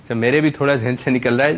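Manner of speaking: ordinary talk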